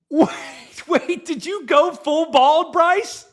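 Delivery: astonished double-take, slowed down; surprised disbelief. Real, not acted. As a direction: drag it out in disbelief. Shocked at cohost going bald.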